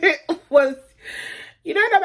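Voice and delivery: high, loud words through laughter; laughing. Real, not acted. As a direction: try to talk while cracking up. Laughing at the actor's accent.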